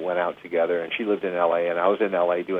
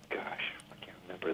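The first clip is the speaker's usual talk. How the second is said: under-breath whisper; whispered to self; 17 dB quieter than usual. Real, not acted. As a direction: whisper it while racking your memory. Muttering to himself, searching memory.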